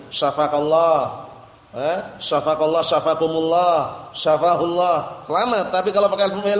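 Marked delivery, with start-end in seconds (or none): 0.1-1.3 Syafaqallah said slowly and deliberately, a falling close; model Syafaqallah. Shown as a demonstration. Teacher models the safe prayer for students, slow. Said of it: "pakai fi'il madhi". 2.2-5.2 each form said slowly and carefully; slow model forms. Shown as a demonstration. Teacher lists the prayer forms for students, slow. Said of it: "pakai fi'il madhi".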